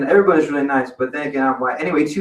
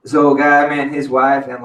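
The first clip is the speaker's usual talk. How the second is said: normal talking voice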